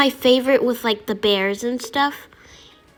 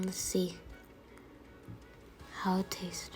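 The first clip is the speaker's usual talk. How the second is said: whispering; 5 semitones lower, 19 dB quieter than usual. Real, not acted. Whispered before tasting.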